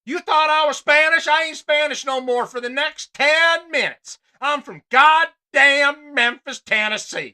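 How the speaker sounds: hammed-up fake Southern accent, loud and high. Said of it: "A O C doing the same thing in Memphis".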